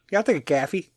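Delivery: Chicago flattened vowel, "caffee"; hammed-up Midwestern Chicago "coffee"; Chicago accent. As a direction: answer in a put-on Chicago accent. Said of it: "you know, Chicago accent".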